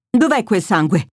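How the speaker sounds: ordinary delivery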